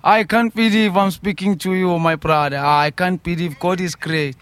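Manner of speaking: normal talking voice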